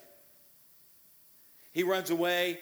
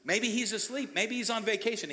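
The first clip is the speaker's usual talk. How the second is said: pitched way up, sarcastic taunt; mocking; 8 semitones higher, 3 dB louder than usual. Elijah taunting the false prophets, high sarcastic mockery. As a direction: needle them with fake concern. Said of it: "He's mocking them".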